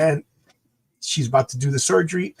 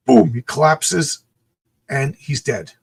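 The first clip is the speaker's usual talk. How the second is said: usual speaking voice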